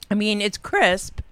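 ordinary talk

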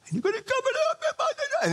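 very high, fast, laughing plea; mock plea. Caller asking the pastor to come help, in a high laughing squeak. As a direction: squeak the request through a laugh.

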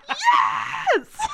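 laughing high shriek of joy; ecstatic shout. Pretend. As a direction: scream with overwhelming delight. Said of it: "you're like".

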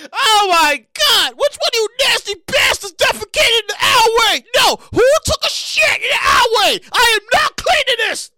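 high, loud disgusted yelling; outraged shout. Real, not acted. Yelling at whoever defecated in the alley. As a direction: bellow it in disbelief.

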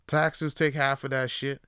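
ordinary talk, nothing marked

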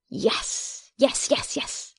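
breathless whispered yells of joy; whisper scream. A narrator's reading. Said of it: "he whisper screamed".

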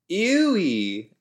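high, loud grossed-out squeal; disgusted. Real, not acted.

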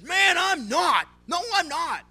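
high, loud, clipped shout; intense, short denial. Acted. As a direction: bark the denial, short and hard. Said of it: "the intensity and the shortness of his statement".

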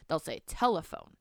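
ordinary delivery

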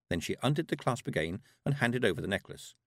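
plain reading voice

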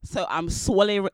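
talking into the mic up close; too close to mic. Real, not acted. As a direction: mouth right on the mic. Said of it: "She is gobbling up the mic".

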